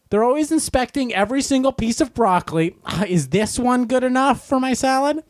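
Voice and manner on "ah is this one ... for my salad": fussy shopper. Retold or imitated. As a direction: mimic a dithering shopper weighing broccoli. Mocking slow salad-bar shoppers.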